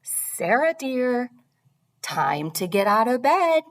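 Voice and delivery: lightly voiced father coaxing, lifted pitch; gently rousing call with a rising lilt; father's wake-up call. Father waking his daughter for school, lightly voiced coaxing.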